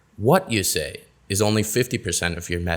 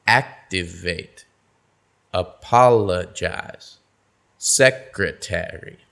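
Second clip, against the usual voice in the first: Activate, apologize, secretary said slowly, lower, first stressed syllable stretched; stress two syllables back; 4 semitones lower, slower than usual. Shown as a demonstration. Teacher modelling stress two syllables before the suffix, slow. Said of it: "Notice how the stress falls".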